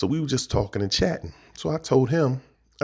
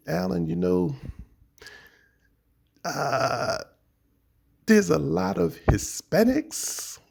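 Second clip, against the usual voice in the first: slow trailing off; 6 semitones higher, much slower than usual. Retold or imitated. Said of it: "faded out".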